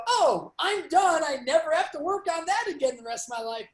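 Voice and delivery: hammed-up naive satisfaction; mock relief. Acting out naive belief of being finished.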